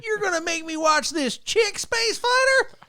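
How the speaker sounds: very high, slow whining complaint; mock a crying fanboy; high wailing mock fan; whiny fan. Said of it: "They're like".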